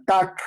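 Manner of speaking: "tac" with a hard k at the end; sound demonstrated. Shown as a demonstration. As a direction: stop the tongue on "tac". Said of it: "está haciendo como una K". ("tac" con la k final marcada)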